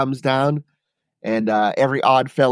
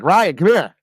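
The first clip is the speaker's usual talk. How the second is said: higher, louder urgent summons; imitated calling out; 7 semitones higher, 5 dB louder than usual. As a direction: imitate the boss calling someone over. Boss calls him over, retold.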